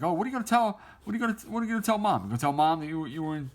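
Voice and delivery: high, needling mock voice; mocking taunt. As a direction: tease them for threatening to tell Mom. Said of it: "And he's like".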